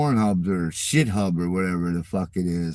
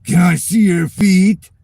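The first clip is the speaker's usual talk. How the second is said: high, loud mocking creep voice; creepy fan voice; 8 semitones higher, 10 dB louder than usual. Streamer mocking a viewer asking for feet, in a loud, high creep voice. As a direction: mimic the weirdo pleading to see feet. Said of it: "One of them wants".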